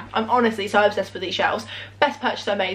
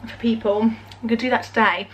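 usual speaking voice

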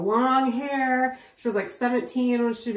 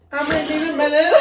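laughing; 8 semitones higher, 7 dB louder than usual. Real, not acted. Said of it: "I got the giggles".

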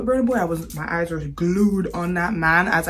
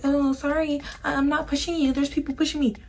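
high, soft mocking voice; mock her excuse in a small high voice; mimicking a fake apology; 7 semitones higher, 5 dB quieter than usual. Mimics the pushing girl's excuse.

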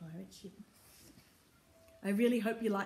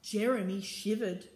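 usual speaking voice

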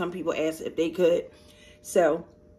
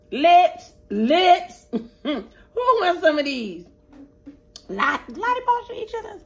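high, silly squeal of lips, lips; playful lip call; 10 semitones higher, 5 dB louder, slower than usual. Real, not acted. Teasing viewers over the chitlins, high giddy squeal.

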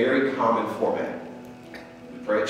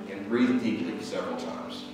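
slow, soft meditation voice; guided meditation; 7 dB quieter, slower than usual. Acted. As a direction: guide them into stillness, slowly.

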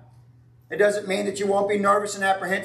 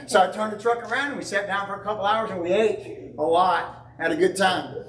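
normal talking voice

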